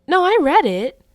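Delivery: high, loud protest; a kid shouting that she did read it; defensive denial. Retold or imitated. Child caught not reading.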